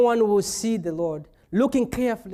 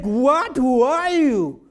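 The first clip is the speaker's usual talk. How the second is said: confused; 3 semitones higher, 6 dB louder, slower than usual. Retold or imitated. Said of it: "That's like".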